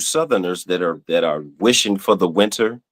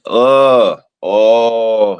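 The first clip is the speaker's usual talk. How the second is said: loud, drawn-out groan, raised in pitch; exasperated groan; 3 semitones higher, 5 dB louder than usual. Real, not acted. Streamer irked by pumpkin-sweater Southerners, loud exasperated groan. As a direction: groan out your irritation. Disgusted by fall lovers' habits.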